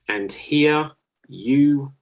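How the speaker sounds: slow, stretched game-show cue; slow dramatic announcer cue; drawn-out host cue. Acted. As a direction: drag it out to build suspense. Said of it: "host cue".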